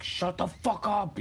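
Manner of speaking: high, slow mocking yell; mock angry guy yelling. Mocking how guys yell at her, slow high yell. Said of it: "when guys yell at you".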